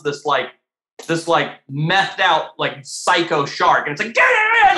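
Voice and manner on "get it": high, loud sudden burst of frenzy; frenzied shout. Retold or imitated. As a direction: snap into a crazed attack cry. Mako suddenly flips psycho.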